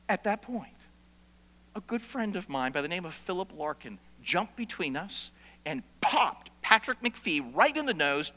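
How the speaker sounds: usual speaking voice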